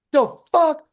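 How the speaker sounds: high, loud burst of indignation; outraged yell. Retold or imitated. Guitarist to manager who cut the sound, outraged. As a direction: act out drunken outrage at being cut off. Acting out his drunken outrage.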